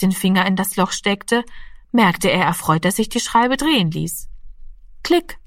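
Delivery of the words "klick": short high-pitched dial click; imitated click. A narrator's reading. The telephone dial moves under Rami's finger, imitated click. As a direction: make a quick, high click with the word. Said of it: "machte es".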